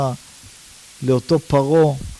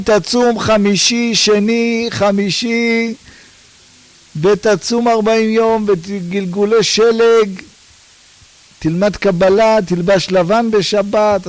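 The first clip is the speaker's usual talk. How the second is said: higher, louder mock preachy list of practices; hammed-up lofty tone listing fasts and rituals; mocking pious list; 6 semitones higher, 4 dB louder, slower than usual. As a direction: rattle off the pious chores with irony. Mocking expected pious advice.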